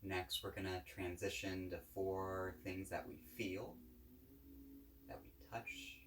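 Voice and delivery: calm, unhurried guided-meditation voice. Real, not acted. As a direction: guide listeners gently, with long pauses between phrases.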